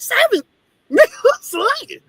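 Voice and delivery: can't believe he does seven loads; shocked disbelief. Retold or imitated. Hearing that the driver does seven loads a day, shocked and laughing. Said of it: "I'm like".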